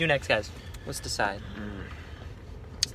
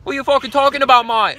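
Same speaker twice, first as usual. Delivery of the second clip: high, loud, fast mock Cockney; hammed-up London geezer; British accent; 13 semitones higher, 9 dB louder, faster than usual. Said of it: "I sound like the guys from Kingsman".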